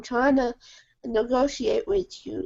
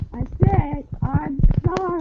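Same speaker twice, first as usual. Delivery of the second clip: angry apology; 4 semitones higher than usual. Real, not acted. Repeats apology, exasperated.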